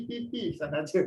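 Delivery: usual speaking voice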